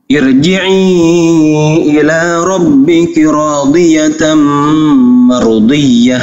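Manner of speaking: slow, melodic tajwid recitation; Quran recitation. Shown as a demonstration. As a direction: chant the verse slowly like tilawah. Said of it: "recites Quran verse". (bacaan tartil yang lambat)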